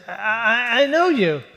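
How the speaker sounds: a fan stammering with excitement; eager stranger. Retold or imitated. Stranger accosting the justice.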